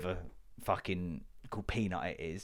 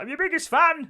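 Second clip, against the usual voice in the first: much higher, loud gushing squeal; mock superfan; 8 dB louder than usual. Imagined superfan gushing at him, high squeal. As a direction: gush like an obsessed fan. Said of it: "Come up to you, like".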